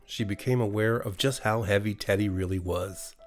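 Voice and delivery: plain reading voice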